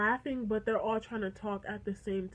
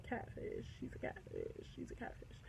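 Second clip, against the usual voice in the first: hushed, voiceless whisper; whispered suspicion; 16 dB quieter than usual. Acted. Texter whispering to herself that he's fake, whispered. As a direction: whisper it like a private verdict.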